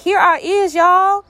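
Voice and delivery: high, playful folksy call; mock casual, high-pitched hello; jokey announcing. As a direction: announce yourself with comic cheer. Jokey paraphrase of Jesus announcing himself.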